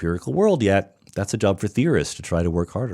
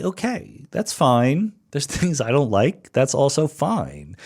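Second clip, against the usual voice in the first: higher, easygoing voicing of a carefree reply; breezy shrug; 6 semitones higher than usual. Advising non-math fans what to say, in a relaxed, higher, shrugging voice. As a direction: toss it off lightly, no big deal. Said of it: "just say".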